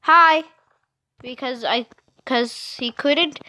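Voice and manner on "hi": loud called-out hi; speaking loud. Shown as a demonstration. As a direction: call it across the house. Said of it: "I had to speak loud like this".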